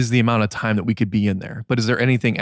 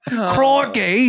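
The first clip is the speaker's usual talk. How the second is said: put-on Australian accent; 12 semitones higher than usual. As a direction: shout it like a jolly Aussie. Australia joke, accent requested.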